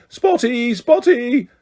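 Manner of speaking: high-pitched jeering call; taunting yell. A narrator's reading. Peeves chases Myrtle with nuts, yelling tauntingly. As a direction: jeer it high, twice over.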